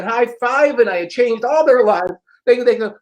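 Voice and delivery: ordinary delivery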